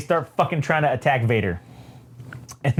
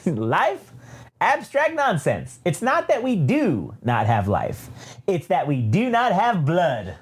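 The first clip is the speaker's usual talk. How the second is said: scornful, swooping droid voice; theatrical villain with big pitch swoops; evil droid; 4 semitones higher than usual. Acted. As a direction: dismiss it as a haughty droid. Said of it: "evil ass triple zero pops in and says".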